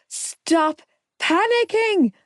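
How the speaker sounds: high, slow scream in pain. A narrator's reading. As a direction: scream it out through labour. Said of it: "I screamed".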